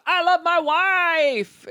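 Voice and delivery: slow, high, syrupy mock; sappy mock reply. Mocking the fiancé's text, slow and sugary. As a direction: stretch it out sickly sweet.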